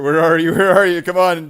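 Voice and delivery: loud, high yelling out; calling out. Real, not acted. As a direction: shout for a missing friend. Calling out for missing friend.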